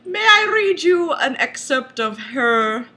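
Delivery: normal talking voice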